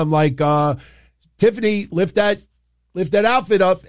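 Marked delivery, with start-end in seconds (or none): 1.4-3.8 higher-pitched imitation of yelling out; mild retold yell. Fan recalls yelling at a wrestler during the match, mild mock yell. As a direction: call it out like a half-hearted heckle. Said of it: "I'm yelling out".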